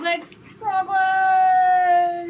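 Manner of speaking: high, loud and drawn out; a silly, excited frog-game shout; playful shout. Pretend. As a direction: call it out big for the kid.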